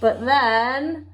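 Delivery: lively storybook read-aloud with character voices; story time voice for a children's picture book. Acted. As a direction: read it to a class of little ones, bright and expressive.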